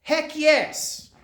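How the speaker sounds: loud, high burst of defiance; retold angry outburst. Grieving mother snaps at the chaplain, loud and high. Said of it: "She said".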